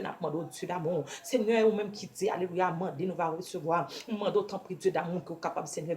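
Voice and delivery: normal talking voice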